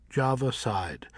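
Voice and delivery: plain reading voice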